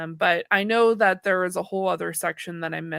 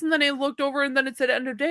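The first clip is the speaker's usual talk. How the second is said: ordinary delivery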